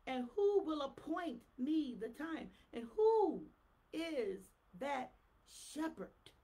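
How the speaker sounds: loud, slow, rising proclamation; fiery preaching. Acted. Preacher roaring Jeremiah's prophecy to the church, loud and slow. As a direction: thunder the prophecy at them.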